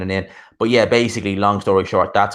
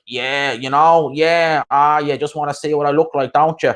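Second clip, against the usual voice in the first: higher-pitched mock show-off, drawn-out opening; cocky Dublin wanker; 5 semitones higher, 3 dB louder than usual. Mocking the wankers people fall for, as a cocky show-off. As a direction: mimic an obnoxious lad full of himself. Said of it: "just because they're like".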